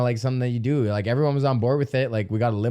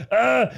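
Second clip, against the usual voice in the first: high yelp of pain; mock cry as the shoulder pops; retold pain cry; 8 semitones higher, 4 dB louder than usual. Retelling the longest dislocation, a high yelp. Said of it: "But this time it was like".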